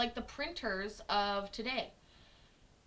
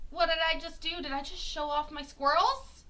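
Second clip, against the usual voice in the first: higher, rising coy questions; playful mock surprise; 5 semitones higher, 4 dB louder than usual. Streamer showing off her squirrels, higher playful mock surprise. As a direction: feign surprise, pitch lifted. Teasing reveal of squirrel background.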